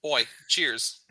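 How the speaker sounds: put-on heavy British accent. NPC toasting the party in heavy British accent. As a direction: mumble it like a British drinker. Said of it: "heavy British accent".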